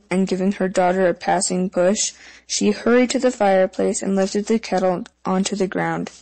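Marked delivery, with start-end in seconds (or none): none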